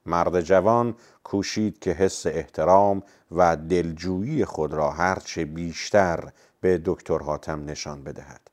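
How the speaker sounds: plain reading voice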